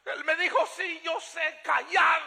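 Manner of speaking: full-force revival sermon delivery; impassioned preaching. Acted. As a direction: cry it out to the congregation, drawing out the phrase ends. (predicación apasionada a toda voz)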